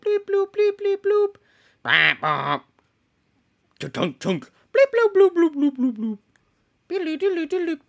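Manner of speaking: high bleep-bloop noises; computer bleeps. Retold or imitated. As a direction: chirp like an old computer. Imitating computer sounds.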